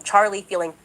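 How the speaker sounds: ordinary talk, nothing marked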